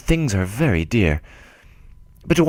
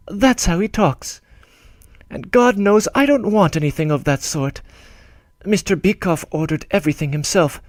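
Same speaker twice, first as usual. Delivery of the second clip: higher, pleading voice of a worried bride; anxious young woman; 7 semitones higher than usual. Acted. Bride writing anxiously to her old friend, higher and worried. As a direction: read her letter as a fretful young woman, pitched up. Reads Varvara's letter in her voice.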